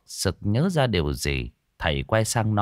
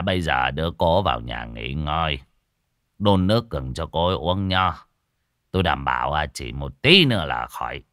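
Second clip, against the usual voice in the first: reassuring; 6 semitones lower than usual. Acted. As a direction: settle the worried family gently. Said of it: "Thầy Toàn lên tiếng trấn an". (thầy Toàn trấn an gia đình, giọng trầm, nhẹ)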